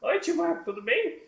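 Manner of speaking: very high doll voice; squeaky-high puppet hello; little puppet greeting. Acted. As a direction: pipe up as a puppet greeting each friend. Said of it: "ela vai falar comigo".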